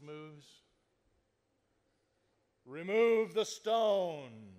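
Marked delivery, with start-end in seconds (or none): 2.7-4.5 slow, much louder commanding proclamation; movie Jesus. Retold or imitated. Jesus at the tomb, movie style, slow booming command. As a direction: boom it out like an epic film. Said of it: "picture it in one of those Jesus movies".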